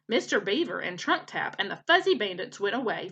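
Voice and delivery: ordinary delivery, nothing marked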